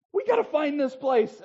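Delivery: loud, high frantic re-enactment; retold urgency. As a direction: call it out as if racing to find it. Retelling frustrated, hurried search in Rome.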